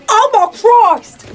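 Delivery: loud drawn-out horrified cry; horrified realisation, shouted; shocked. Real, not acted. Realising photos shared publicly.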